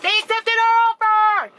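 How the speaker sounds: high, loud burst of joy; excited. Retold or imitated. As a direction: blurt out the big news. Retelling husband's happy news.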